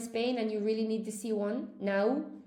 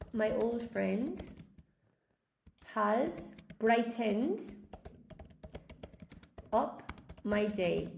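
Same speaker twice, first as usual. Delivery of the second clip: slow demo; much slower than usual. Shown as a demonstration. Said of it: "We can say".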